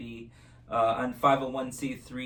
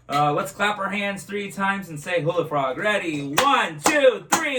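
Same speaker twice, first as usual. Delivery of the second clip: put-on kids party magician; 4 semitones higher, 6 dB louder than usual. Kids magic show host.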